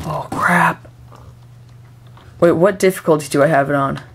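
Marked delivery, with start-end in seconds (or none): 0.1-0.9 whispered curse; hushed dismay. Real, not acted. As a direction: let it out under your breath. Danger noticed in game.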